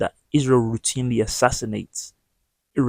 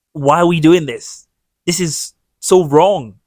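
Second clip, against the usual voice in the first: loud, high, drawn-out protest; impassioned, raised-voice plea; indignant appeal; 7 semitones higher, 9 dB louder, slower than usual. Real, not acted. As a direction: slowly cry out in moral outrage. Indignant appeal against supporting racism.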